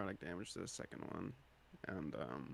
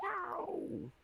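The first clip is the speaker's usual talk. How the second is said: very high, drawn-out ow; high-pitched yelp. Acted. Player as the sneak attack lands, high yelp.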